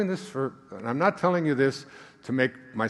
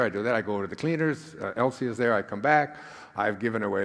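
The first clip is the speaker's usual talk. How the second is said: normal talking voice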